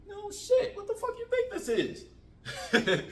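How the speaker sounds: very high, slow mocking squeak; squeaky mock voice.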